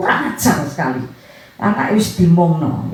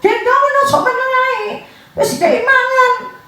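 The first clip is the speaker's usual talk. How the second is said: very high, squealing mock yell of complaint. In-law yells at mother about the crying child, mock yelling. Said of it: "bisa-bisanya dia teriak".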